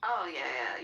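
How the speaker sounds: ordinary talk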